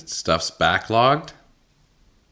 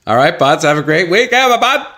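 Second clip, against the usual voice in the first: loud, much higher, rising goodbye; cheery sign-off; 8 semitones higher, 6 dB louder than usual. Real, not acted. Signing off to the buds, bright and loud. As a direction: beam it out like signing off a show.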